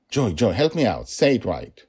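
loud, urgent appeal for help; flustered father begging his daughter; playful pleading. A narrator's reading.

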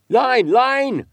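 high, loud harpooner's shout over the water; urgent cry. A narrator's reading. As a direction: call out the alarm at full voice. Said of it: "cried Queequeg".

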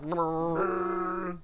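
long rolled vibrating brrr; imitated metal chair. Podcaster imitating a metal chair echoing in the room, buzzing rattle. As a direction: mimic a metal chair reverberating. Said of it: "That metal chair was like".

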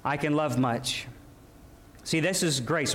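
ordinary talk, nothing marked

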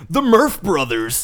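announcer voice. Pretend. Announcing joke duo name.

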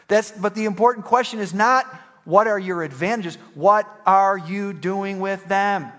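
high, loud, insistent preaching; forceful preaching. Acted. Pastor pressing the congregation on what they were given, forceful preaching. Preaches at raised pitch and volume.

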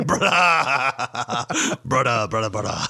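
put-on drunk giggle. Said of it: "laughing your balls off".

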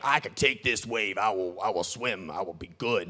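low macho voice; mock tough-guy sailor, pitched low; tough Navy man. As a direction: drop way down, stoic and sure of yourself. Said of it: "Navy man that's like".

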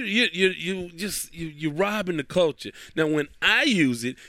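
ordinary delivery

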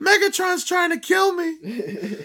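high-pitched, drawn-out comic yell; shouted in exaggerated alarm; mock panicked shout. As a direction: cry out like a hammed-up movie victim.

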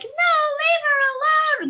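high-pitched imitation of wailing children; upset kids. Retelling how the kids reacted to the ducks, mock childish wail. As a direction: cry out like protesting kids. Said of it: "all of my kids are like".

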